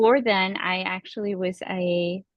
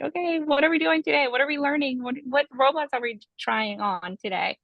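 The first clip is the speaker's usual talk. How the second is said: high, eager run of questions; retold childhood excitement, pitched up and eager; can't wait to get started; excited kid; 6 semitones higher than usual. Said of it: "I was so excited to go".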